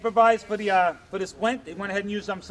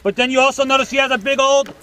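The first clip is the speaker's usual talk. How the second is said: louder scenario briefing; loud instructor call-out; 5 semitones higher, 12 dB louder than usual. Acted. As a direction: project over the noise. Instructor calls scenario over noise.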